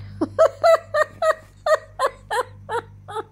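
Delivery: high, loud laughing fit that tips toward crying; helpless high laughter. Real, not acted. Caught out by the dog, helpless high laughter. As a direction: laugh until it almost breaks into tears. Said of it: "I'm out of breath".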